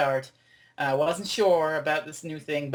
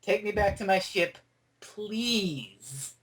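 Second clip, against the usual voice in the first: polite request; 4 semitones higher, much slower than usual. Retold or imitated. Fan voicing her corrected request to the Doctor, grudgingly polite. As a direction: give in and ask nicely, stretching the last word. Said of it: "get it right".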